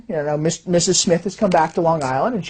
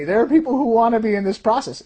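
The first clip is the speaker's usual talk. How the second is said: normal talking voice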